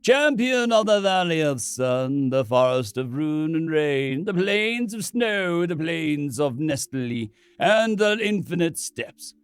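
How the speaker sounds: solemn priest. Acted. Priest chants titles slowly.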